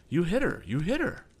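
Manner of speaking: high, rising delighted cry; excited cry. Acted. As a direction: cheer the hit. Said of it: "cried Captain Weston".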